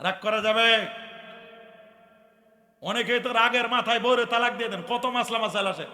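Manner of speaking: loud, high preacher's cry; fiery preaching. Acted. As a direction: call out over the gathering. Preaching waz at full force.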